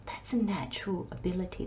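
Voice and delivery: hushed, confiding whisper; soft intimate whisper. Real, not acted. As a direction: breathe it like a secret. Soft emphasis on a reader's gift.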